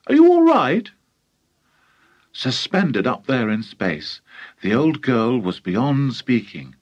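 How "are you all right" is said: put-on high, loud boyish question; polite asking. A narrator's reading. George checking on stunned grandma, politely. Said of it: "George asked her politely".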